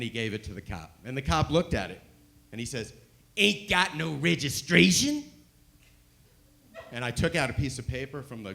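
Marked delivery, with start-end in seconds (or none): 3.4-5.2 put-on slow, louder hillbilly accent; the Southern cop doubting them, in a hillbilly drawl. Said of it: "biggest hillbilly accent I'd ever heard".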